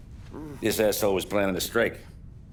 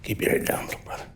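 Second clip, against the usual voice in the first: softer, breathy whisper of concern; whispered warning; 5 dB quieter than usual. Acted. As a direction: lean in and whisper it. Parting caution to his brother.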